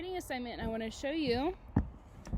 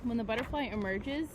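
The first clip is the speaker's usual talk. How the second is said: put-on reading to children; 10 dB louder than usual. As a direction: read it like a big picture book to the class. Reads book aloud to class.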